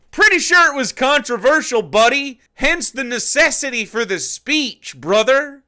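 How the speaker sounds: high, loud exasperated retort. Real, not acted. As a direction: shout him down in disbelief. Angry rebuttal to video.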